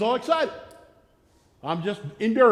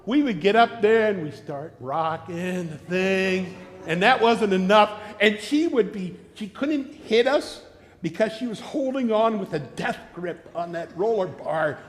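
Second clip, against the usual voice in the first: ordinary talk, nothing marked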